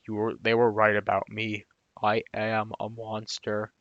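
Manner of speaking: lumbering, downcast monster voice, badly faked; terrible Hulk voice. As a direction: do a half-hearted Hulk, deep and slowing down. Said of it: "That was a terrible Hulk voice".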